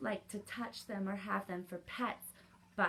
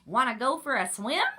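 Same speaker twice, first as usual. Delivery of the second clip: loud, higher question, rising at the end; duck character; 3 semitones higher, 10 dB louder than usual. A narrator's reading. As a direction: call out the duck's invitation. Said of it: "called the duck".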